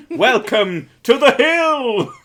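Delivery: high, slow, loud laughing welcome; hammed-up grand welcome with a laugh; mock tour guide. Joking about Texas's one hill as a grand tour guide. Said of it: "mock tour guide".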